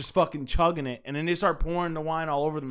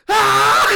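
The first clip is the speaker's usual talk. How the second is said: high, loud mock scream; imitated workout scream; 12 dB louder than usual. Host acting out pranksters at the gym, screaming. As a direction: scream like you're straining through a rep. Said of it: "they start going".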